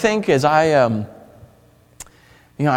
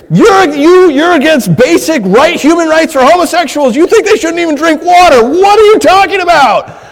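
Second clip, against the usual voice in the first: high, loud accusing rant; angry accuser; 9 semitones higher, 7 dB louder than usual. Retold or imitated. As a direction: fire the charges at him one after another. Imagined accuser attacking.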